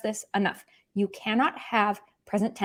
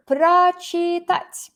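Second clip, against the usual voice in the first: прочитать drawn out slowly, higher; slow demo word; 8 semitones higher, 5 dB louder, much slower than usual. Shown as a demonstration. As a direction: model прочитать slowly for students. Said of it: "this verb".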